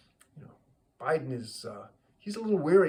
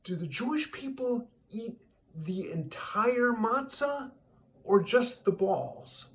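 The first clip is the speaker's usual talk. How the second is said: high-pitched naive question; Biden; 10 semitones higher than usual. Acted. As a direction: deliver the punchline as an earnest, raised-voice query. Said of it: "he says".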